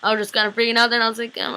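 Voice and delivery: normal talking voice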